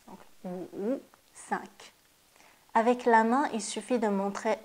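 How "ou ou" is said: « ou » grave et très doux; « ou » plongeant, à mi-voix; wu troisième ton doux. Shown as a demonstration. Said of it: "le troisième ton". (wu doux au troisième ton)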